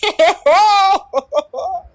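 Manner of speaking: high, slow, spelled-out OMG into a laugh; on the big screen, high giddy OMG and laugh. Retold or imitated. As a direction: spell it out in disbelief, then laugh. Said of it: "And I'm like".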